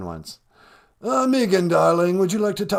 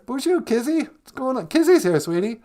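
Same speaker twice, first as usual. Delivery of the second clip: higher, bright greeting to a chatter; excited greeting; 11 semitones higher, 4 dB louder than usual. Real, not acted. Host greets Kizzy joining the chat, excited. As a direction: call out to a friend arriving.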